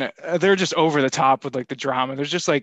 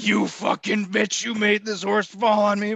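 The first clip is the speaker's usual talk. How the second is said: a higher, slow hammed-up mom berating her daughter; mock angry mother; 7 semitones higher than usual. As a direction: play the furious mother, half joking. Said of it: "It was like".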